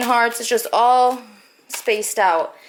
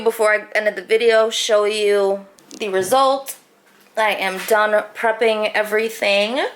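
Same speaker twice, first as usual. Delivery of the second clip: ordinary talk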